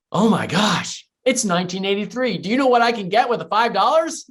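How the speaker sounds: put-on like a kid handed a treasure; elated. Boy to grandfather over a five dollar bill, thrilled. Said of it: "I was just elated".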